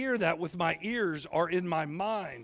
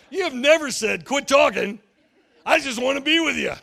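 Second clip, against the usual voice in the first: higher, louder disbelieving outburst; incredulous protest; 6 semitones higher, 9 dB louder than usual. Retold or imitated. Preacher protesting to God in disbelief, loud incredulous. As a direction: protest to God in mock outrage. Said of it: "And I'm sitting over there thinking".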